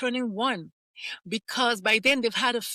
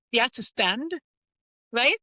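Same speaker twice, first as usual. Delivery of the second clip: usual speaking voice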